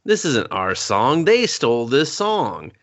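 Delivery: slow, mock-indignant complaint; imitating complainers. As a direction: draw it out like a whining accusation. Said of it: "And they're like".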